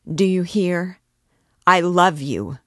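put-on the kneeling priest presses his love on the girl, loud; passionate exclamation. A narrator's reading. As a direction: force the words on her, impassioned. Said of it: "he again exclaimed".

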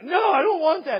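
loud, high mock child's refusal; refusing kid. Kid rejects every dinner offer, loud and stubborn. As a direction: play a brat turning everything down. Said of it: "the kid doesn't want".

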